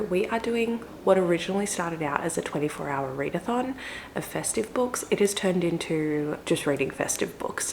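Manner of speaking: soft, hushed voice so nobody wakes; talking quietly. Real, not acted. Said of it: "I'm talking really quietly".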